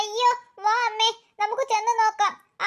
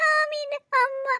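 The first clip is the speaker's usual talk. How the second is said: put-on higher, soft little girl voice; toddler voice; 5 semitones higher, 4 dB quieter, slower than usual. Aami asks for her mother in a tiny voice. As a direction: say it like a small child. Baby names herself.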